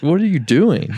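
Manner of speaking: sibling woken at night, mock-annoyed; mock groggy irritation. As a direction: demand an answer, half asleep. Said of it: "you're like".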